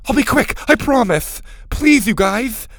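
high, loud, pressing promise; desperate plea. A narrator's reading. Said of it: "Max said desperately".

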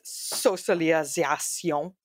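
"socialisation" drawn out slowly, as in French; French pronunciation. Real, not acted. Host announcing the next topic, weirdly French. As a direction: slip oddly into French on "socialisation". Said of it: "said it very weirdly and in French".

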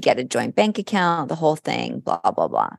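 ordinary delivery

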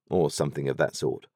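usual speaking voice

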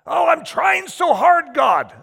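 high, loud, slow frustrated plea; frustrated cry. Acted. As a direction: cry out to God in exasperation. Frustrated believer crying to God.

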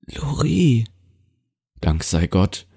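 suddenly recognizing his granddaughter; grandpa's sudden relieved recognition; startled grandfather. Acted.

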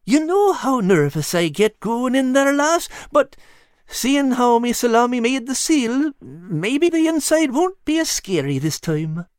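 cat hoping it won't be scary; nervous cat. Acted. Al voiced, nervous.

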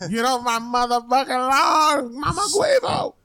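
hammed-up grumpy old man hollering; mock old man yell. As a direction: yell at the kids off the lawn. Old man yelling at kids.

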